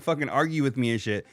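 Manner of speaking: normal talking voice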